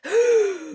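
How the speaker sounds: loud, exaggerated gasp; long hammed-up inhale; big deep breath. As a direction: imitate a creature sucking in a huge breath. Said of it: "it takes a big deep breath".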